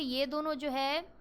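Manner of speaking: normal talking voice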